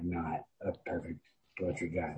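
ordinary talk, nothing marked

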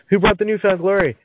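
high, peppy party greeter voice; excited party host. Pretend. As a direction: greet the crowd like an eager host.

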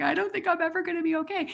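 high, quick, tearful-sounding wail; hammed-up retold crying voice; mock weeping. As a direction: imitate yourself blubbering to a stranger. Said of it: "I was weeping on this plane".

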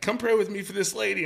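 mock mom. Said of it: "my mom was like".

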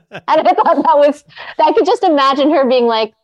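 laughing through the words, high; amused, giggling while speaking. Real, not acted. Telling the guest about the email, laughing.

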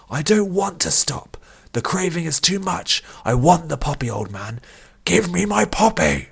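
high, strained, craving-driven shout; desperate shouting. A narrator's reading. Addict pinned down demands his poppy, shouting desperately. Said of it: "Vish shouted back".

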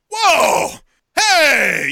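high, loud retold yell from the sideline; coach screaming. Soccer coach screams at the referee, loud and high. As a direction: bark it like a coach losing it.

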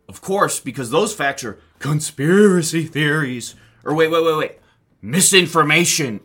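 sarcastic mockery. Mocking the media's line.

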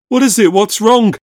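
high, loud, urgent questions; loud alarm; alarmed questioning. A narrator's reading. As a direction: burst in demanding answers, panicked. Sam bursts in alarmed.

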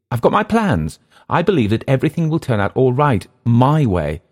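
high, insistent character voice; defensive Englishman. Acted. Englishman defending his plans to his host, high and insistent. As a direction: assert it eagerly, pitched high.

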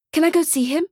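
put-on high eager little boy; child voice. A narrator's reading. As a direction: ask like an excited little boy. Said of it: "asks the boy".

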